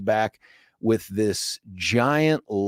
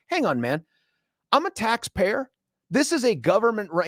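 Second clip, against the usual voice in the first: high, indignant protest; retold customer standing on his rights; elevated indignation; 8 semitones higher than usual. Taxpayer arguing with government clerk, elevated indignation. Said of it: "plus a little elevated voice".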